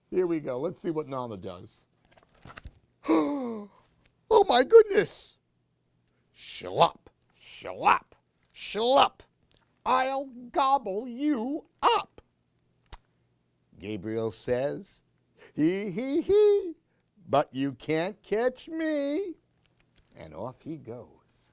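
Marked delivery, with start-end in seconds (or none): 3.1-5.4 much higher, loud astonished cry; amazed. Real, not acted. Reveal of Nana monster. 6.4-12.2 wobbly hammed-up slurping monster; jelly monster. 15.5-19.3 mock giggle rising high; giggling boy. Gabriel giggles.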